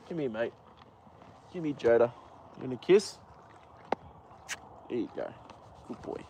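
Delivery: soft, murmured coaxing to a horse. Real, not acted. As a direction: coax him close, barely above a breath.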